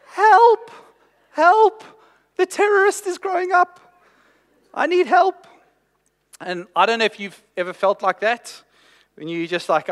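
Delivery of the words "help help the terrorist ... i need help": high, loud mock panic with a laugh in it; panicked cry for help. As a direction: cry out like a desperate, overwhelmed new dad. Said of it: "this feeling of".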